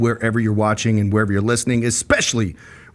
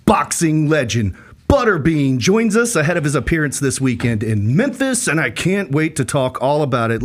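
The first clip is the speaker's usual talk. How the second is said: usual speaking voice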